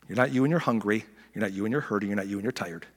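normal talking voice

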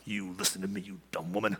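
low, soft mock threat; mock menacing. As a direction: hiss it at her like a bully. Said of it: "I don't think he was like".

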